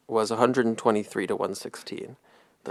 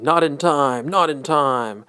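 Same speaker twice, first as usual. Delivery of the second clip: mock announcer yell, higher and a bit louder; screaming announcer imitation; 4 semitones higher, 5 dB louder, slower than usual. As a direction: mildly imitate a screaming play-by-play call. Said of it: "The announcer was screaming".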